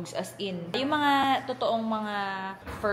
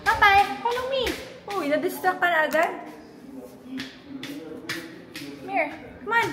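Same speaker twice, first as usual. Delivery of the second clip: calling puppy; 8 semitones higher than usual. Real, not acted.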